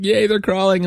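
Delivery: high-pitched happy parent cheer; excited. Retold or imitated. Proud dad cheering his crawling baby, excited. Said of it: "you get so excited".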